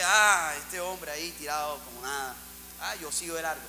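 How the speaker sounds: low, soft dismissive mumble; a light sketch of someone brushing it off; indifferent levite. Acted. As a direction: levite walking past, muttering it off. Levite ignores the wounded man.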